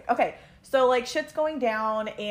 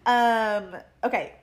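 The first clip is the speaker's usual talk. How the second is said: ordinary talk, nothing marked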